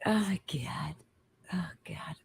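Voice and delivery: very soft, voiceless aside; whispered dismay. Real, not acted. Woman reacting to his crude remark, whispered dismay. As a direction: whisper it to yourself.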